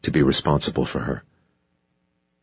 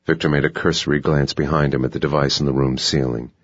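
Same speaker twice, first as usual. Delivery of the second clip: normal talking voice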